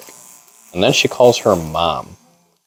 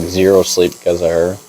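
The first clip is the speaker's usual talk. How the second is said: usual speaking voice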